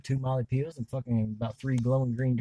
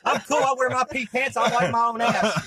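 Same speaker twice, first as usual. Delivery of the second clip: laughing; 10 semitones higher, 6 dB louder than usual. Real, not acted. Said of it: "I forgot we was on recording".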